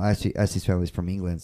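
ordinary talk

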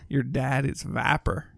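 put-on Southern accent, Viper said as vah-per; Southern drawl. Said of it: "Where you from, Georgia?".

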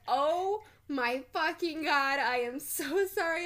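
a hammed-up sorry after spilling her friend's drink; gushing apology.

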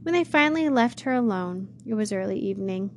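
ordinary delivery

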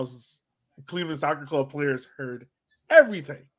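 normal talking voice

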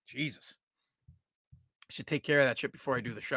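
sniffly, talking while wiping nose; muttering through a runny nose. Real, not acted. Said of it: "it's like wiping my nose".

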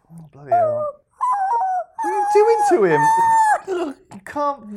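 high, loud, squealing protest; shrieking outrage. Pretend. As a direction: shriek as if appalled. Mock outrage at dog treatment.

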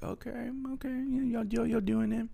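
high, sing-song mock approval; mock impressed. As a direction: cheer them on like watching kids try. Mock approving the clumsy moshers.

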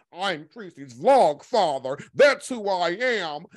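slow, emphatic mock pronouncement; Jay Wilson impression. Commentator mimicking his claim to be vlog father, slow mocking impression. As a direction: announce the title grandly, mimicking him, words stretched. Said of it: "He say he ain't got no friends on YouTube".

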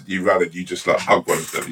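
ordinary delivery

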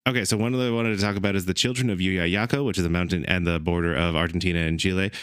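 rushed, fast delivery; fast talking. Shown as a demonstration. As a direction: rattle it off quickly. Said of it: "say them as fast as you can".

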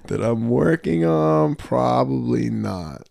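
very slow, stretched-out answer; drawn-out pondering. Real, not acted. Impressionist mulling a question, slowly. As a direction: draw each word out as you mull it.

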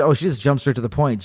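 ordinary delivery

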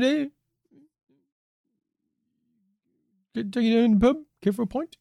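high, hammed-up British pub talk; mock UK accent. Host voicing himself inviting the alien to the pub, mock British. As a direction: play a chummy Brit inviting him out. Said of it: "our various UK accents".

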